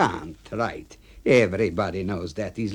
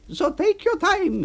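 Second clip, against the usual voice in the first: much higher voice, shrugging it off; dismissive A B. Acted. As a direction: brush off the complaint. AB mocking.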